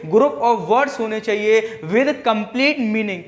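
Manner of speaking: drawn out word by word; slow definition. Shown as a demonstration. Teacher drilling the definition of a sentence, slowly. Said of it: "teacher stressing the definition".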